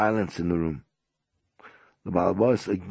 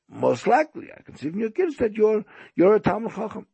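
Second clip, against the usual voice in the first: high, animated, raised voice; impressed host; 12 semitones higher, 5 dB louder, faster than usual. Retold or imitated. Host to guest who made Kiddush, impressed.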